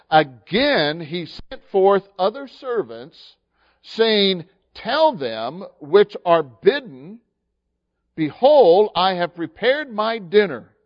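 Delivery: slow, loud, declaiming King James verse; preacher declaiming scripture slowly and loudly; scripture reading. Acted. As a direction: proclaim the verse like a herald.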